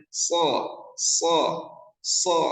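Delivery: so with a thick emphatic s; heavy shad. Shown as a demonstration. Teacher drills the letter shad, thick s. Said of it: "huruf shad ini".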